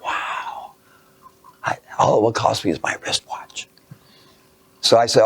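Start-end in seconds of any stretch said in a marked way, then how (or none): 0.0-3.7 whispered wonder; hushed awe. Retold or imitated. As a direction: whisper it to yourself, amazed. Kid's awed thought, whispered.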